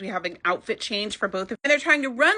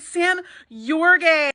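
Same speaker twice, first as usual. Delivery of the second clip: slow, drawn-out put-on voice, higher; deadpan Ivy; 6 semitones higher, 5 dB louder, much slower than usual. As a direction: stretch each word out as a pointed reveal. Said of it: "And then Ivy Lynn's like".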